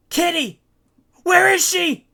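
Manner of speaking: high, frantic loud cries; panicked shouting. A narrator's reading. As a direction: yell for the missing girl. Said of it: "Giff begins to panic".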